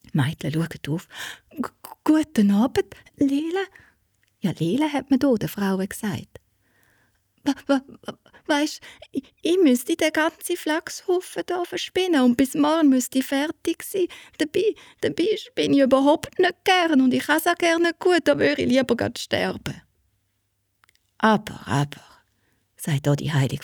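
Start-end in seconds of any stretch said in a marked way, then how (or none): 1.5-19.8 put-on high, stammering, teary little girl voice; girl sobbing out her trouble to the kind saint; tearful girl. 21.2-22.0 soothing whisper. Acted. Saint soothes the crying girl.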